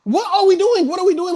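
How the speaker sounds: so outraged the voice breaks up high; cracking, squeaky outrage at the patch. Real, not acted. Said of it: "my voice is sitting there cracking".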